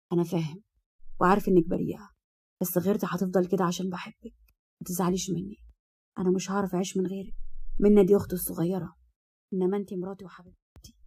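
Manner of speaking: hushed, tender reassurance; soft soothing husband. A narrator's reading. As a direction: murmur love to calm her, very softly. Husband soothes wife, declares love. (زوج بيصالح مراته بهمس حنون)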